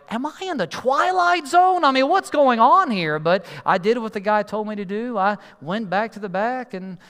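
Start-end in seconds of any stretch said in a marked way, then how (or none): 0.1-3.2 high, loud exasperated disbelief; mock-baffled, pitched way up; bewildered. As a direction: ask it as if nothing makes sense. Bewildered reaction to the heckling.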